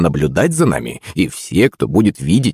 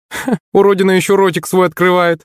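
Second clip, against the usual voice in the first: high-pitched mocking schoolgirl; taunting girl; 12 semitones higher than usual. A narrator's reading. Redhead girl stepping up to insult Yulenka, taunting. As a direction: jeer in a raised, girlish voice.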